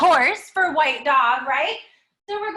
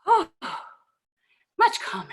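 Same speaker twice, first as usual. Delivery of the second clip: relieved sigh. Real, not acted. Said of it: "Let me see if that works for me".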